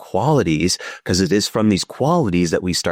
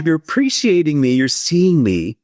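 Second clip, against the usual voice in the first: delighted part; 9 semitones higher, 3 dB louder than usual. Retold or imitated. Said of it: "they're like".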